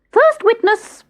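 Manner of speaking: very high, loud squeaky call; rabbit calling out. Acted. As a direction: pipe it out like a herald rabbit.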